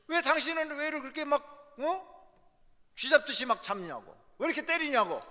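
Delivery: high, loud indignant protest; shrill-edged outrage at the husband for hitting; wife butting in. Acted. Wife confronting husband over how hard he hits the child, high and angry. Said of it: "아내가 또 간섭해요".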